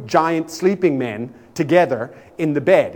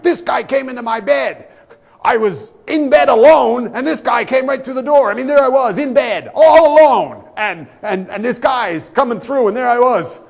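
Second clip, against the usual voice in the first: high, loud wailing complaint; hysterical screaming; 10 semitones higher, 8 dB louder than usual. Retold or imitated. Angry roommate screaming to the desk clerk, high loud wail. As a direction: shriek it like an outraged victim. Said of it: "the guy starts screaming like".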